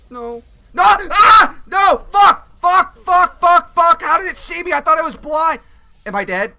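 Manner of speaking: frantic yelling as the monster attacks; panicking gamer. Real, not acted. Monster catches him, screaming.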